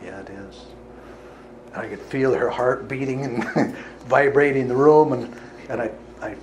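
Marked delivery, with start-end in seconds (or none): 0.0-0.7 dead serious. Retold or imitated. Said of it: "as seriously as I could".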